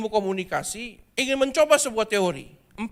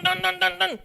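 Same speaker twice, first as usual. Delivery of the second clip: imitated car noise; 10 semitones higher, 3 dB louder than usual. Friend's car approaching.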